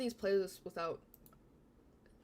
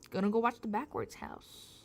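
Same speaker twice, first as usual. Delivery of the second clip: usual speaking voice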